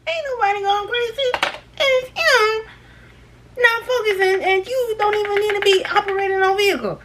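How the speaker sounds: high exasperated inner voice; exasperated inner rant. Retold or imitated. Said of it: "thinking in my head".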